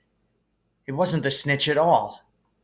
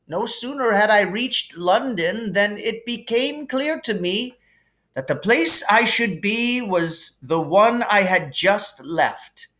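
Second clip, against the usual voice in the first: higher, gently amused headmaster voice; kindly old headmaster; 9 semitones higher than usual. Acted. Dumbledore calming Harry in the hospital wing, gently amused. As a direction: soothe the boy like a kindly wise old wizard.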